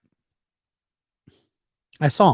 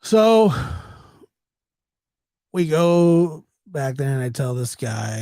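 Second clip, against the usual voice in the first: usual speaking voice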